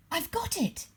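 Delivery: high sudden eureka cry; high joyful discovery; excited cry. A narrator's reading. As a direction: burst out with a bright idea. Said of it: "she cried".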